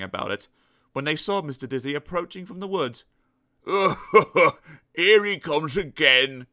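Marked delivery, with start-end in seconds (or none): 3.7-4.5 mocking giggle. Animals mocking Dizzy. 4.9-6.5 giggling. Acted. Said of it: "giggled the clever pig".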